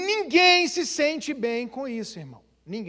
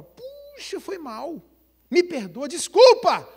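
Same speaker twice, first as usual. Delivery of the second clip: startled apology; 5 semitones higher, 6 dB louder, slower than usual. Retold or imitated. Friend apologizing, surprised.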